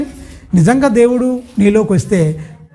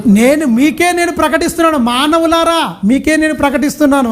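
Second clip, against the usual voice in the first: higher, ringing proclamation of God's voice; scripture proclamation; 5 semitones higher than usual. Acted. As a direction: announce it like a prophet to the crowd. Proclaiming God's words in verse.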